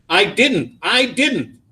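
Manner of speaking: put-on high-pitched little boy's denial; protesting child. A narrator's reading. Small boy wrongly accused defends himself, protesting high. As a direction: insist on innocence, pitch leaping up.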